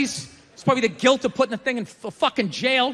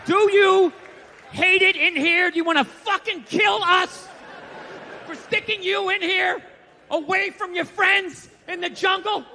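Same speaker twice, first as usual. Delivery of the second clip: mock pleading shout; 5 semitones higher than usual. Lady urging the captive gorilla, hammed-up shout. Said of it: "sign to the thing like".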